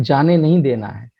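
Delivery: usual speaking voice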